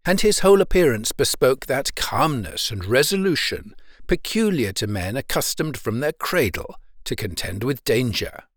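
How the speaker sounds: plain reading voice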